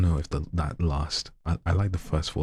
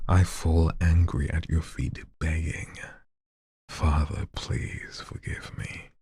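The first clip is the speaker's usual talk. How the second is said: the line rebuilt, ending in a whisper; re-reading a favourite line with drama; spicy dramatic reading; slower than usual. Retold or imitated. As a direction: savour the line, let the plea fall to a whisper. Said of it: "I made it spicy".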